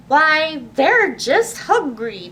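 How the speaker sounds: a hint of a booming mayor, slowed; mayor declaring. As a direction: announce it like the town's mayor. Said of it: "declared the mayor".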